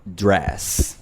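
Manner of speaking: "dress" with an open English E, almost "drass"; English dress vowel. Shown as a demonstration. Teacher modelling an English word. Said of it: "como en la palabra inglesa".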